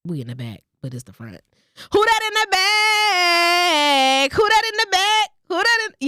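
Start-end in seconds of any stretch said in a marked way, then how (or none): 1.9-6.1 high, slow sing-song call; playful call-out. Pretend. Vlogger jokingly calling to coworkers in the back, high sing-song call.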